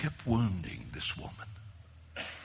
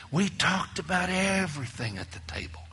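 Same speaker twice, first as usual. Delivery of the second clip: ordinary talk, nothing marked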